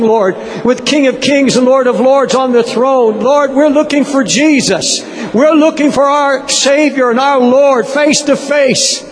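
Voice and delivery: high, ringing worship proclamation; fervent praise. Real, not acted. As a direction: lift your voice in impassioned praise. Leads worship at full force.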